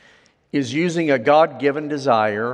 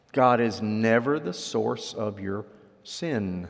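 ordinary delivery

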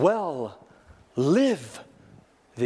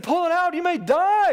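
alarmed warning; 12 semitones higher, 4 dB louder than usual. Retold or imitated. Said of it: "They said".

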